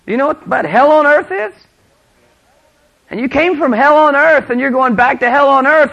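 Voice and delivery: high, loud, driving sermon delivery; fiery preaching. Real, not acted. Preacher challenges leaders going back to hell, fiery. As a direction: build to a pulpit shout.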